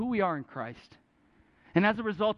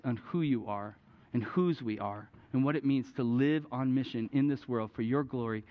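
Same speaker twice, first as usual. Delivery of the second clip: closing prayer; 6 semitones lower than usual. Real, not acted.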